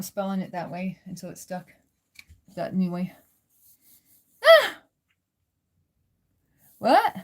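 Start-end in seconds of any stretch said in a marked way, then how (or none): 4.4-4.8 sudden very high, loud cry of surprise; startled yelp. Real, not acted. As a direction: yelp out, caught off guard.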